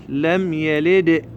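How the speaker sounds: slow recitation, يلد released as "yalid-e"; middle dal bounced. Shown as a demonstration. Teacher points out the middle dal, bounced in recitation. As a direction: chant slowly and bounce the د of يلد. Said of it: "هذا الدال الوسطي".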